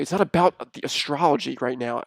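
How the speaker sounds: ordinary talk, nothing marked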